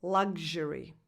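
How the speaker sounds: luxury said LUG-zhuh-ree, voiced gzh. Shown as a demonstration. Coach gives her own way of saying luxury with gzh. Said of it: "can also be pronounced".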